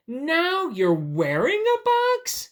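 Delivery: very high, loud, drawn-out disbelief; stretched-out, amazed question; incredulous question. Acted. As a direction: squeak it in astonishment. Reads the question to ask bunny.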